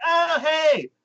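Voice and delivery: high, gushing proud grandpa; doting grandpa. Retold or imitated. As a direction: brag about the grandson, beaming. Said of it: "and he's like".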